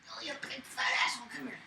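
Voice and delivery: quick, soft, affectionate; fond teasing. Real, not acted. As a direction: coo the insult lovingly. Fond insult to dog.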